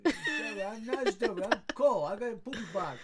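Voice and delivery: cat voice. Retold or imitated. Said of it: "she's like".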